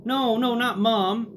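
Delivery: mock panicked plea. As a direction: beg in a rush of panic. Begging grandma not to bring mom.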